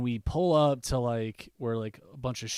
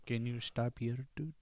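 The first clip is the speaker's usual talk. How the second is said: ASMR whisper; 2 semitones lower, 8 dB quieter than usual. Retold or imitated. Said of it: "it was like A S M R".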